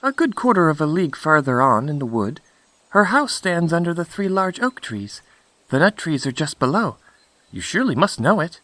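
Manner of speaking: put-on high, quick little girl voice; innocent Little Red Cap. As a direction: rattle off directions in a high child's voice. Red Cap gives directions.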